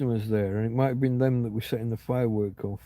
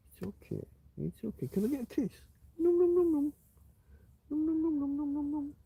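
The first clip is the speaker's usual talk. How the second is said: much higher, sing-song pet voice; 13 semitones higher, 5 dB quieter than usual. Real, not acted. Baby talk to dog for kiss.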